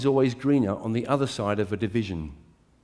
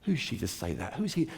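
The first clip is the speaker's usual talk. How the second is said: soft, petty muttered grievance; grumbling inner voice; 8 semitones lower, 8 dB quieter than usual. Retold or imitated. Preacher voicing the resentful voice in his head, softly. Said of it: "a little voice in my head saying".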